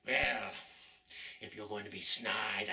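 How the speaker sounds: ordinary talk, nothing marked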